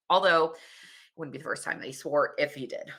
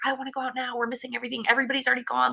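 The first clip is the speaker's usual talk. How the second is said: put-on higher, whiny kid voice, impatient; freaking-out kid; 8 semitones higher, much faster than usual. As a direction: play a kid who can't wait to go out. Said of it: "he's freaking out".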